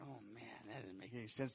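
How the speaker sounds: low, very soft mock grumble; hushed, deflated aside; muttered regret. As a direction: mutter it under your breath as second thoughts. Voices a believer regretting a vow.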